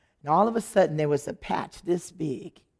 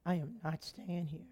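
much softer, muttered protest; under breath; 2 semitones lower, 15 dB quieter than usual. Retold or imitated. Wife protesting the barn to Lewis, muttered softly. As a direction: mutter it aside to your husband. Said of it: "looking at Lewis like".

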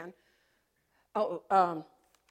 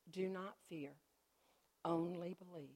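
quiet voice of God; 3 semitones lower, 9 dB quieter than usual. Retold or imitated. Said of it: "And then I heard".

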